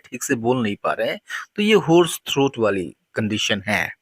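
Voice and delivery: ordinary talk, nothing marked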